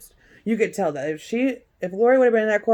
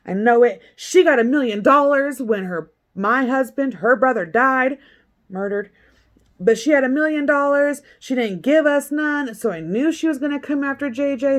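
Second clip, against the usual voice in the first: higher, louder, worked-up rant; loud upset Kay; 5 semitones higher, 5 dB louder than usual. Retold or imitated. Imitating Kay ranting at the detective, louder. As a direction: rant it hot and fast like someone furious. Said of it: "she's like".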